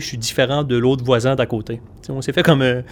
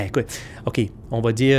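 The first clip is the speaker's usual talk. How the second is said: ordinary delivery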